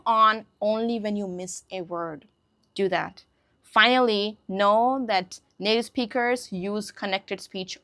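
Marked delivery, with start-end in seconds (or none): none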